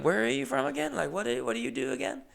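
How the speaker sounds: very high imitated girl's voice; mimicked girl passenger. New friend quizzes the other, high mimicked girl voice. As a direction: pitch it way up like a girl asking casually.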